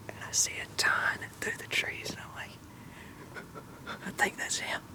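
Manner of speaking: hushed, excited hunter's whisper. Real, not acted. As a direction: whisper the story so nothing in the woods hears. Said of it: "I don't even know I'm whispering".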